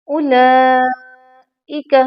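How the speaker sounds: उलाइका very slow, the इ held to the limit; उलाइका drawn out to its full length. Shown as a demonstration. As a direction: stretch उलाइका slowly but no further. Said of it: "इससे ज़्यादा इसको लंबा नहीं करेंगे".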